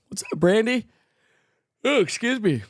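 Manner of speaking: talking through a yawn; mid-yawn, stretched words. Real, not acted. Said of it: "The yawn got me".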